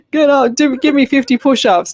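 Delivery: barking orders like a gym coach; personal trainer voice. Pretend. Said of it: "I've got my P T hat on right now".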